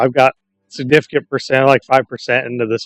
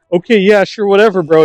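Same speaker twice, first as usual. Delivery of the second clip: mock giving up; 8 semitones higher, 4 dB louder than usual. Retelling sketch, giving up.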